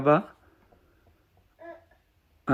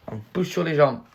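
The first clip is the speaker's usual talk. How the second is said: ordinary delivery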